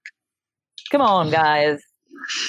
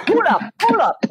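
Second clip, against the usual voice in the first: DJ call; 13 semitones higher than usual. Retold or imitated. DJ call continuing the joke.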